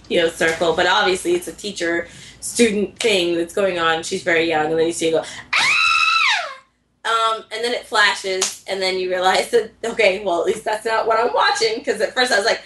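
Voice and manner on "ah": imitated very high, sustained shriek; high-pitched squeal. Storyteller imitating what is heard in the anime scene, high squeal. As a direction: let out a long, thin mock squeal.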